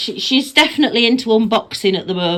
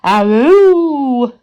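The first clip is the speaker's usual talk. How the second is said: imitated dog aroo, louder; jackal howl; 6 dB louder than usual. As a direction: copy the dog's howl back. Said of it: "What are you arooing at me for?".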